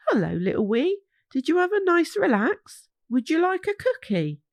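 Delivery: kind Mama. Acted. Mama greets little Wee in her studio, sweet and high. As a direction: offer it like a fond mum.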